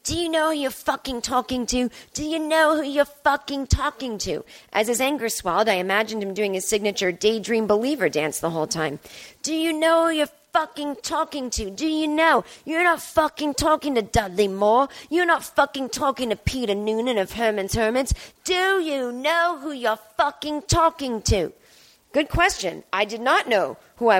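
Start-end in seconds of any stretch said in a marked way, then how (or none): 0.0-4.4 put-on drunk British rock star; angry British accent, slurred. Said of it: "He said in that British accent". 9.4-21.5 put-on drunk angry British rocker; shouting in a British accent. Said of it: "in that British accent".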